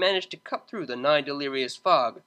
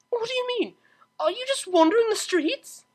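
much higher anxious mare voice; urgent worry; Octavia. Acted. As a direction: cellist worried on the phone, higher. Octavia worried.

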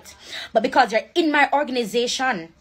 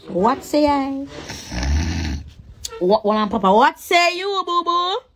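playful mock voices; 2 semitones higher, slower than usual.

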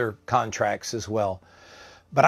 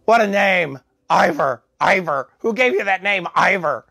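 higher-pitched, incredulous imitation; scoffing patient; 6 semitones higher than usual. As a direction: scoff at a silly name, voice raised. Retold mockery of his name.

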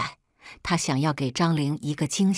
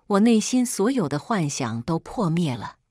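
usual speaking voice

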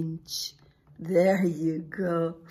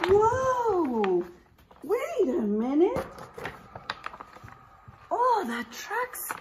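mock surprise; 10 semitones higher than usual. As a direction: gasp at the trucks for the kids. Teacher reacts to story picture.

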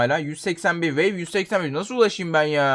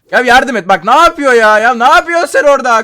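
loud, higher complaint at a teammate; louder, higher scolding of a teammate; exasperated yelling; 6 semitones higher, 9 dB louder than usual. Real, not acted. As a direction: yell at a useless teammate. Teammate fails to help.

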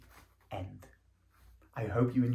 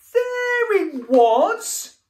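high, loud call out to sea; high drawn-out shout across the beach; 12 dB louder, slower than usual. Acted. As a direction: yell after the rabbits. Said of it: "he shouted".

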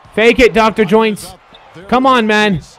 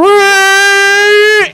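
sudden drawn-out cry, much higher and louder; excited yell; 9 semitones higher, 4 dB louder than usual. Real, not acted. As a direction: let out a long thrilled yell. Excited play, yells at screen.

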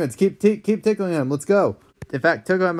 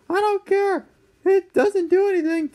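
stubborn cranky dad. Acted. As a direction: drag out the refusal, squeaky.

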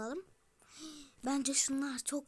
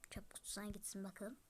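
whisper; 5 semitones lower, 11 dB quieter than usual. Real, not acted. Aside while throwing items away.

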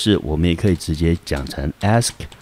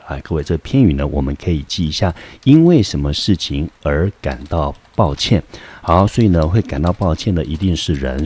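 ordinary talk, nothing marked

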